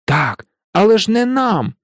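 high-pitched, exasperated plea; desperate. A narrator's reading. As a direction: plead in mild despair. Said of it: "у відчаї мовив Рон".

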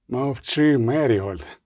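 ordinary delivery

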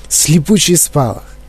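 plain reading voice

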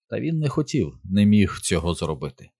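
plain reading voice

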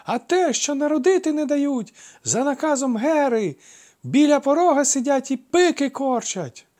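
very high, loud screech; shrill shriek. A narrator's reading. Shrieking woman tells crowd about Hera's order. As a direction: keep shrieking the news.

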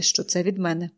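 put-on older woman's voice on що це від мене; aunt's voice. Aunt says it.

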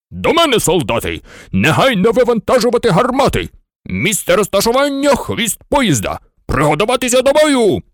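high-pitched general's orders; military command. A narrator's reading. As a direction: call the troops to arms, voice climbing. General orders soldiers into battle. (високі командні вигуки генерала)

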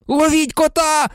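high, loud shriek; shrieking Ron. A narrator's reading. Ron shrieks at everyone to catch the cat. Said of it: "заверещав Рон".

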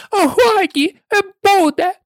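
high-pitched impatient screech; shrill, irritated royal outburst; screeching queen. A narrator's reading. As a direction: cut them off with a squeal. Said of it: "верещала королева".

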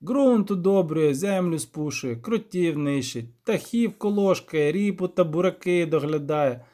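rustic village elder. Acted. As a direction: draw out each chore in dialect.

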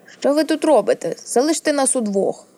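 an upset sister ordering intruders off; angry outburst. A narrator's reading. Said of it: "крикнула їй сестра крізь гнівні сльози".

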